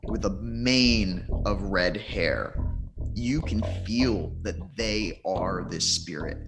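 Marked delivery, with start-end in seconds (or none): none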